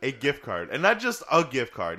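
ordinary delivery

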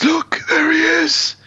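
high, slowed cry of discovery; mock alarm. Voicing alarmed man spotting Michael.

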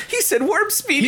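put-on high, giddy nerdy kid voice with a laugh; nerd voice. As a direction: squeal it like an excited little nerd. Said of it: "that was a little nerd voice coming out there".

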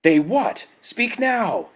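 very high, loud furious demand; thundering demand. A narrator's reading. Tagath demands the worker finish, thundering. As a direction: roar at him to speak. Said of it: "thundered Tagath".

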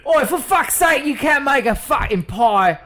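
high, drawn-out exasperated Brit; mock British accent. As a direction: rant slowly in hammed-up British. Riff as angry British customer.

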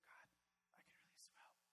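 barely audible whispered prayer; whispered plea. Acted. Driver heading to the bank pleads with God, whispering. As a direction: whisper it privately to God, very softly. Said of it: "you're driving to the bank, and you go".

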